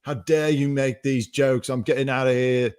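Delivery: prissy mock exit speech; mock indignant. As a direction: act offended and storm out. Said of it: "stand up and be like".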